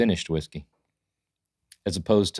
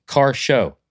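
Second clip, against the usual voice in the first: raised, punchy exclamation; emphatic announcer shout, higher pitch; half-yelled title; 6 semitones higher, 6 dB louder than usual. Shown as a demonstration. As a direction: call out the show name with an exclamation point. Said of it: "Are you yelling?".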